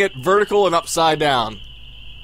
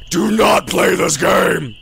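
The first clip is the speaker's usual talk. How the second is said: mock dramatic warning; slower than usual. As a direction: boom out the warning. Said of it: "reads warning in mock shout".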